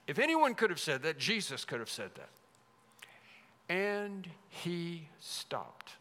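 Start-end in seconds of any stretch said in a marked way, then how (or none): 3.7-5.9 very slow, drawn-out, measured delivery; slow, weighty reverence. Acted. Preacher marvelling that Jesus stopped, slow and weighty. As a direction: stretch every word and let it settle.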